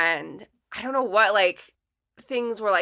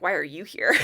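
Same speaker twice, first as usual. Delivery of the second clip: lower-pitched mock question breaking into laughter; blunt puzzlement; 4 semitones lower than usual. Guest translating old coworker's real meaning, bluntly amused. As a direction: say the undiplomatic version, amused. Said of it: "find a nice way of saying, like".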